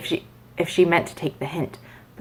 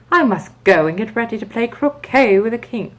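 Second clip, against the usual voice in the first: lightly voiced Duchess, slightly higher; a hint of the hurried Duchess; 5 semitones higher, 3 dB louder than usual. A narrator's reading. Duchess leaving Alice, lightly voiced.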